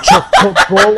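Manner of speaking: a loud burst of high laughter at a shock; startled laugh, high and loud; laughing surprise. Real, not acted. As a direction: laugh out at what just happened. Surprised by the game.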